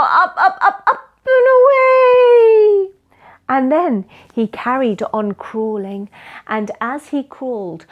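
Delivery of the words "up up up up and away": high, loud, climbing cheer; excited call. Acted. Storyteller heaving everyone back upright, rising excited call. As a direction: stretch it out long and high at the end.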